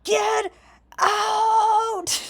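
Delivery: high furious roar, full volume; raging shout. Acted. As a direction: bellow it like a barbarian in a rage. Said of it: "And he goes".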